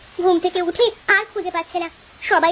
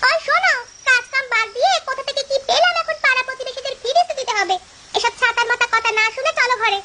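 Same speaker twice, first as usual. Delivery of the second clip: scolding wife; 6 semitones higher than usual. Acted. Wife scolding her husband indoors.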